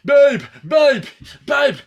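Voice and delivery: high, loud, tongue-tied yelling; panicked tongue-tied calling; tongue stuck to palate. Retold or imitated. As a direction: shout it with tongue glued to mouth's roof. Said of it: "Why are you talking like that".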